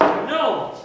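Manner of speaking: sudden loud, high outburst; emphatic refusal. Acted. Friend refusing another restaurant, loud high outburst. Insisting during dinner argument.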